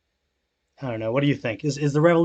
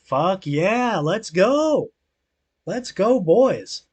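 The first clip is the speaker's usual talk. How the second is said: excited cheer; 7 semitones higher, 4 dB louder, much slower than usual. Real, not acted. Cheering news of general strike.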